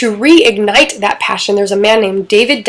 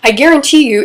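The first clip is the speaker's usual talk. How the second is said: ordinary delivery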